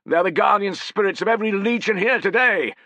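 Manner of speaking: high, loud, elated; overjoyed, voice lifted high; excited. Acted. Centurion ecstatic at the eagles, telling his men. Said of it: "beside himself with excitement".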